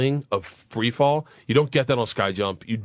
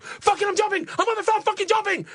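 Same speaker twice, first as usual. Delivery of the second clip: very high, frantic yelling; re-enacted freak-out before a leap; losing it. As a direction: blurt it out in a hysterical panic. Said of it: "I just started fucking losing it".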